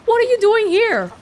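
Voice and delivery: startled. Acted. Detective startled by villain's arrival.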